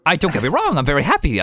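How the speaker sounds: high and flustered, covering himself; flustered brother. Acted. Brother assuring his sister he is glad, high.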